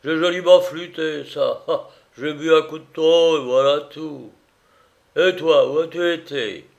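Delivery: loud, rambling tipsy voice; boozy, insistent chatter; drunken master, chummy. A narrator's reading. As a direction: ramble merrily, stumbling over words.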